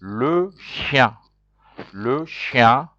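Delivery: Le chien, lo shyan, first falling then rising; model French word. Shown as a demonstration. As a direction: model Le chien twice for students to echo. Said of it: "So repeat after me".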